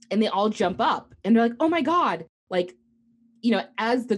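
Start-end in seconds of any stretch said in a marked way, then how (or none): 1.6-2.2 high, loud alarmed "oh my God" as parents leap up; startled cry, pitched up and louder; alarmed gasp. Retold or imitated. Parents jump up as girl runs.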